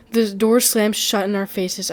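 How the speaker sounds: ordinary delivery, nothing marked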